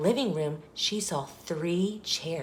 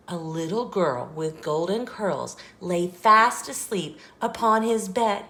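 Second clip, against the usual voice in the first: usual speaking voice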